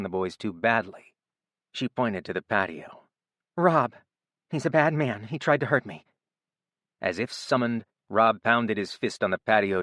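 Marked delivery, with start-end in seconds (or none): 3.6-6.0 shaken. A narrator's reading. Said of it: "struggled to slow down and remain coherent".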